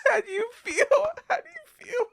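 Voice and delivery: high, laughing through the words; cracking up while asking; laughing squeal. Real, not acted. Laughing mock concern.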